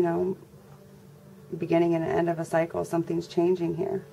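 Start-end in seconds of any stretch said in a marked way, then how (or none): none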